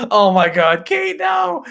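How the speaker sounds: loud, high-pitched protest; startled shout. Real, not acted. Husband yelling to his wife Kate mid-stream, startled shout. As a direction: cry out in laughing disbelief.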